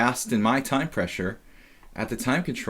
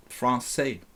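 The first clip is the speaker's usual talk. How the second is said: put-on French accent; 4 dB quieter than usual. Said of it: "My best French accent".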